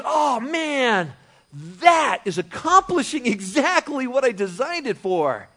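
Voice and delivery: high, loud, impressed exclamation; delighted admiration. Acted. God looks at his tool at work, acted delight. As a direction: marvel loudly, as a proud maker.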